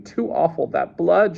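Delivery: high-pitched horrified recoil; shuddering. A narrator's reading. Woman horrified by the blood, high shudder. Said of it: "she shuddered".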